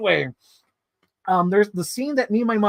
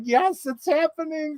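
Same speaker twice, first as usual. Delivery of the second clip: cheering; 9 semitones higher than usual. Retold or imitated. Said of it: "like, cheering".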